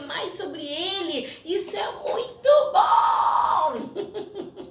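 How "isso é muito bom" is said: high, loud delighted cry with a laugh; excited shout. Real, not acted. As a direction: burst out with joy. Excited exclamation about God. (grito animado e feliz)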